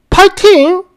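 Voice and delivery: high-pitched mocking question; incredulous echo. Commenter echoing boyfriend's cheer in scornful disbelief. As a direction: throw the cheer back in disbelief.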